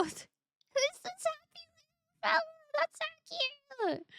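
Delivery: cutesy high voice. Real, not acted. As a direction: coo at it like a puppy. Cooing over a cute drawing.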